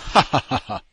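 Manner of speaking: loud, breathy ha-ha laugh; acted laugh. Narrator jokes about the dog's cigarette-colored hairs, acted laugh. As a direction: laugh heartily at your own joke.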